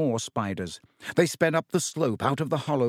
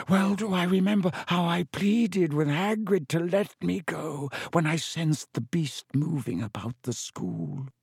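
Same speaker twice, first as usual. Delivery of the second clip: put-on old spider creature voice, higher than narration; Aragog; 5 semitones higher than usual. A narrator's reading. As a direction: intone it as a giant spider. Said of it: "said Aragog".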